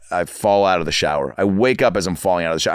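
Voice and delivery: ordinary delivery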